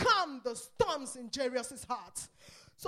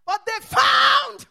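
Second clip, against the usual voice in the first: much louder and higher, slowed shout; impassioned cry; 6 semitones higher, 15 dB louder than usual. Acted. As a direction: let it break out into a cry. Emotional climax of sermon.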